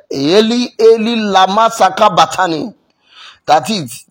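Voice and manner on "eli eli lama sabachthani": raised-voice quoted cry. Retold or imitated. Preacher quoting Jesus on the cross, crying out. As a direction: call it out as from the cross. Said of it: "he cried, he said".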